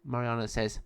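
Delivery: plain reading voice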